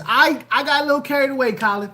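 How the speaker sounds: ordinary talk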